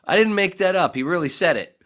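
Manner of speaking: giddy loud protest; excited insistence. Real, not acted. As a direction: insist it eagerly. Insisting while laughing.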